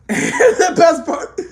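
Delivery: high, laughing through the words. Real, not acted. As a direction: crack up while trying to go on. Laughs at favorite scene.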